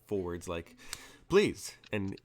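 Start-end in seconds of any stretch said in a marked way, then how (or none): 1.3-1.8 loud, high boss voice; a curt, loud "please"; gruff boss. Acted. Johnson gestures, voiced quote.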